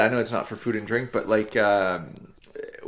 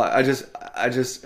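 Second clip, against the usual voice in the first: ordinary talk, nothing marked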